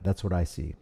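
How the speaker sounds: ordinary talk, nothing marked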